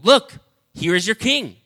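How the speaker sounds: proclaiming. Acted. Pilate presents Jesus.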